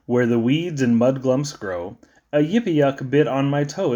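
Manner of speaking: usual speaking voice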